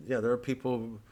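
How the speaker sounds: ordinary talk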